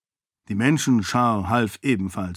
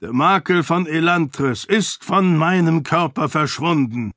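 proclaiming; 5 semitones higher than usual. A narrator's reading. Weakened lord proclaims his healing to the guard captain. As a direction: announce it with all remaining strength. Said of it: "verkündete Herathen mit aller Kraft".

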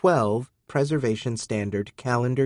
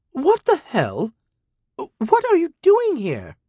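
put-on high, slow startled young woman; lightly voiced teen girl caught out, high; girl's voice, startled; 5 dB louder, slower than usual. A narrator's reading. Teen girl caught by her guardian, high startled. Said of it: "She said".

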